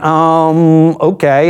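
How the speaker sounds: drawn-out, uncertain stall; imitated hesitation. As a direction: stretch the "um" as if holding something back. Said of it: "Huh, hesitation".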